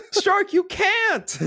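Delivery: high, drawn-out comic shout; mock anime cry. Co-host acting out a plea to Stark, mock anime cry. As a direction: wail it slowly in a high put-on voice. Acts out character calling to Stark.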